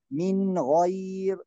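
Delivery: "من" short and clean before "غير"; no hum between "من" and "غير"; correct clear nun. Shown as a demonstration. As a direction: pronounce the N of "من" clearly. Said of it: "ننطق النون".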